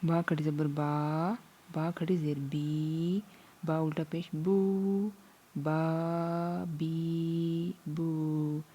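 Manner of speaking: slow recitation. Shown as a demonstration. Teacher demonstrating to class, slow stretched chant. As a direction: draw out each vowel slowly. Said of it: "उसे हम पढ़ते हैं".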